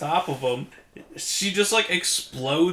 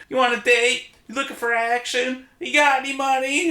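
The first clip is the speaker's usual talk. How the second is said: high, sleazy come-on pitched way up; hooker voice; 9 semitones higher than usual. Retold or imitated. Podcast host voicing Frankenhooker propositioning men, high mock hooker voice. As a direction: toss out the pickup line like a streetwalker. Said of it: "your voiceover of the hooker quotes".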